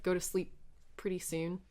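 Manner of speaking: normal talking voice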